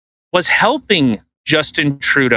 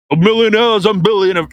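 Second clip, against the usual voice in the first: put-on slurred, marble-mouthed old senator; Bernie Sanders impression; 4 semitones higher than usual. Host mocking Bernie Sanders on his podcast, marble-mouthed impression. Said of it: "That's what he sounds like".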